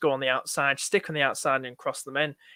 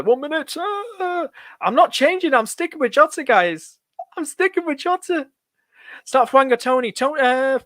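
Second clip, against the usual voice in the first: high, loud, flustered outbursts; real deadline panic, pitch shooting up; frantic countdown panic; 8 semitones higher, 6 dB louder than usual. Real, not acted. Streamer with one minute to the deadline, frantic panic.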